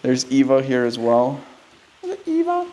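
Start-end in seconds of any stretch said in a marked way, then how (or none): 2.0-2.7 very high soft pet voice; calling a cat. Real, not acted. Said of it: "me calling for the cat".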